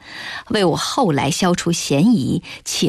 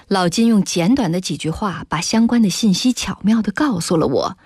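ordinary delivery

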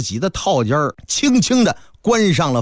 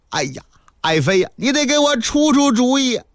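higher, tearful pleading; mock-sobbing plea for help; whiny tears; 6 semitones higher than usual. Dragon King begs his concubine for advice, mock tearful. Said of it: "您怎么还哭上鼻子了呢".

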